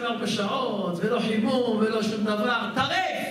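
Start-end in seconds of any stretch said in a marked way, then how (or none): 2.7-3.3 emphatic verdict. Real, not acted. Rabbi declaring the oven unkosher.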